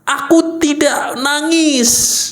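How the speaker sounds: slow, high-pitched recital; drawn-out demonstration statement; deliberate self-affirmation. Shown as a demonstration.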